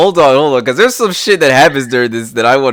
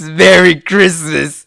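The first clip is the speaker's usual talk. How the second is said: retold scream; 4 semitones higher, 3 dB louder, slower than usual. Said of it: "and screams".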